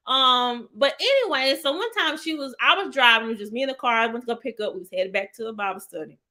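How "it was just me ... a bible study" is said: ordinary talk, nothing marked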